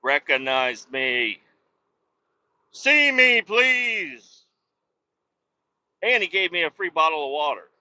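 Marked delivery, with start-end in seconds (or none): 2.7-4.4 hammed-up louder, higher pleading whine; a theatrical please-notice-me wail; mock plea. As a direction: beg to be noticed, half joking. Begging to be recognized.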